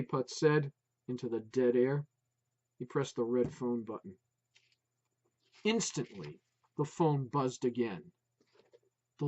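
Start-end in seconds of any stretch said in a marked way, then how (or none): none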